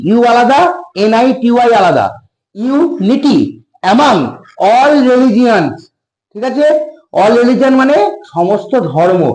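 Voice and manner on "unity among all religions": each word stretched out; slow dictation. Shown as a demonstration. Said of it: "উচ্চারণ দেখবো".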